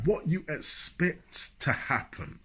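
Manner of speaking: slow, drawn-out preacher cadence; imitating a preacher. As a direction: lightly preach it like a man of God. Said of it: "I'm preaching good now, ain't I?".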